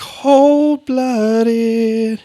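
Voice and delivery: drawn out on one high, held pitch; high sing-song echo. Pretend. Co-host echoes listener's verdict, high sing-song. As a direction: chant it back, high and stretched.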